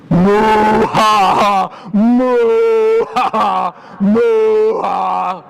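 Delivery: long loud moos with loud ha-ha's; laughing cows. A narrator's reading. Storyteller plays cows laughing at the cow that went oink, mocking moo-laughs. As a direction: moo and jeer like a herd of cows. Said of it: "cows that went moo laughed".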